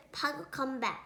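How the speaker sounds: plain reading voice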